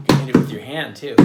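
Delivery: ordinary talk, nothing marked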